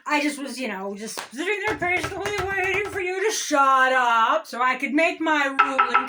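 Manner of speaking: usual speaking voice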